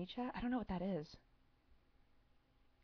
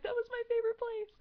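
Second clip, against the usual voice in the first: much higher, protesting the city was great; indignant delight; 4 dB louder than usual. Retold or imitated. Answering the movie's take on Bruges, indignantly excited. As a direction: defend the town with a squeal. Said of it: "and I'm like".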